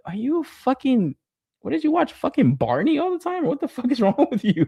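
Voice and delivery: high-pitched, acted-out exasperation; incredulous mock retort. Acted retort to animation complainers.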